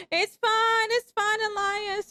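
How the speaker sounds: high, loud, hurried reassurance; squeaky it's-all-fine; high-pitched reassurance. Real, not acted. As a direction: brush off the slip in a high voice. Reassuring friend after a slip.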